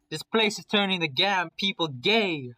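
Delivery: loud, high, drawn-out exclamation; comic outburst. Acted. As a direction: cry it out in exaggerated dismay. Comic outburst after the kiss.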